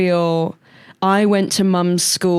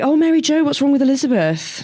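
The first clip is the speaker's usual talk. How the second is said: high-pitched teacher voice; 8 semitones higher than usual. Retold or imitated. Teacher voiced in retold story.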